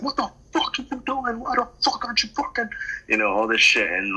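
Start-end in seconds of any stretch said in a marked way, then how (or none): 0.0-2.7 high, fast, squeaky outraged rant; mock road rage rant. Driver retelling cursing out another car, outraged.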